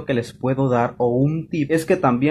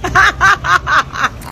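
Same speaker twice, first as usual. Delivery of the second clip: loud laugh; 6 dB louder than usual. Real, not acted. Laughing at own tiredness.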